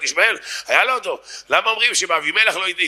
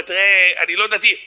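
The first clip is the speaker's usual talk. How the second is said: raised, drawn-out feeble excuse; sick-chick voice; 4 semitones higher, slower than usual. Acted. Heckler admitting he isn't religious, sick-chick voice. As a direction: plead it like an ailing chick. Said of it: "קול של גוזל חולה עם בעיות רגשיות".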